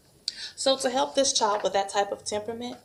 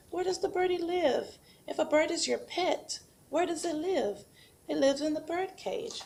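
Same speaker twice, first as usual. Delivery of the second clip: put-on higher, bright sing-song for a small child; sing-song prompt to a child; 8 semitones higher than usual. Shown as a demonstration. Teacher modelling questions for a child, sing-song and higher. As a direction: ask it like a patient preschool teacher. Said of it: "And then you have the bird home".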